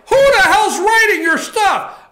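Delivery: high, loud mock outburst; incredulous yell. Host mocking Cagle's speechwriter, loud incredulous yell. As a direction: yell it in disbelief. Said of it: "I'm like".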